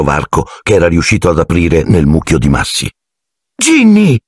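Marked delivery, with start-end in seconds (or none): 3.5-4.2 calling out. A narrator's reading. Ron calls his sister.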